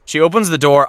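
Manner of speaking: usual speaking voice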